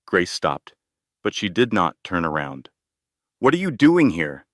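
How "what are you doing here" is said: raised, higher and sharper; blurting it out too loud; an outburst he can't hold back; louder than intended. A narrator's reading. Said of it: "Ethan's voice was louder than he intended".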